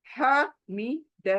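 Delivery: Hamid drawn out very slowly; slow practice. Shown as a demonstration. Teacher showing the slow first reading. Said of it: "पहला slow".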